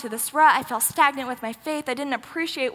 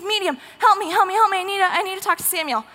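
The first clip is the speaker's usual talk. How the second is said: high, louder begging, acted out; frantic pleading; 6 semitones higher, 4 dB louder, faster than usual. Saul begging a medium to reach Samuel, frantic pleading. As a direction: plead in a hurried, repeated rush.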